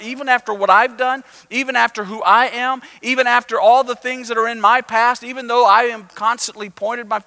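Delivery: rising hope. Retold or imitated. Sinners hearing the parable, lifted with excited hope. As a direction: voice the outcasts' desperate hope. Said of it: "hope kind of rose in their heart".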